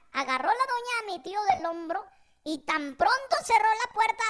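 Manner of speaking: ordinary talk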